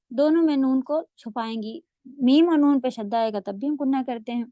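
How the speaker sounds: ordinary delivery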